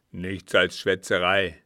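put-on loud, scornful old captain; contemptuous growl; gruff dismissal. A narrator's reading. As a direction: brush it off with angry force. Captain dismisses servant's explanation.

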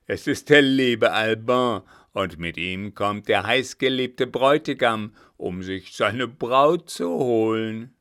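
laut und höhnisch, am Ende langsam. A narrator's reading. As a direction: triumphierend spotten. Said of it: "höhnte er". (höhnender Kapitän verspottet die gefangene Braut)

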